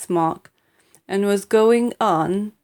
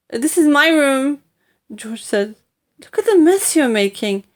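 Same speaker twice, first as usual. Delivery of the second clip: lightly voiced boy, annoyed and louder; protesting boy; 6 semitones higher, 5 dB louder than usual. A narrator's reading. George to Grandma, indignant boy. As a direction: complain about the mess.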